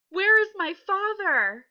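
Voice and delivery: high, loud, slow frightened cry; scared. Shown as a demonstration. Teacher modelling the character for the pupil, scared. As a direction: cry out for a lost father. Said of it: "You should sound like this".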